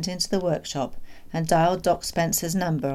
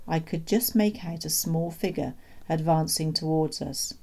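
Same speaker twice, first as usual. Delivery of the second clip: usual speaking voice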